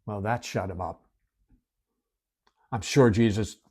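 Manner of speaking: ordinary delivery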